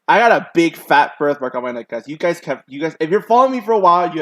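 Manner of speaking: excited rush; excited loud aside. Real, not acted. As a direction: blurt it out to the viewers. Excited aside about own birthmark.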